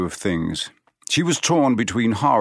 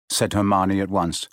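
usual speaking voice

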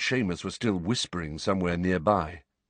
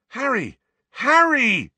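high-pitched shouts, the second louder; urgent calling; 7 dB louder, slower than usual. Acted. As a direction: shout his name to wake him. Ron calls to wake Harry.

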